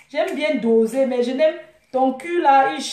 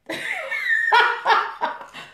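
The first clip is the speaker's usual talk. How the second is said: mocking laugh; 9 semitones higher than usual. Real, not acted. Laughs at her rival.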